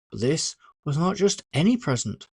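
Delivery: plain reading voice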